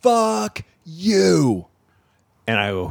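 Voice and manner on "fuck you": heckler's fuck you. Retold or imitated. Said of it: "he goes".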